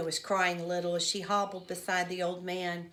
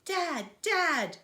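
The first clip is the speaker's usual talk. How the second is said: high-pitched urgent call; yelling; 9 semitones higher, 3 dB louder than usual. A narrator's reading. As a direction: yell for your father through a hole. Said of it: "have to stop and yell".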